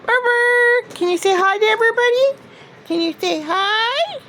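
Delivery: high coaxing pet voice; sweet high sing-song to a dog; talking to a dog. Real, not acted.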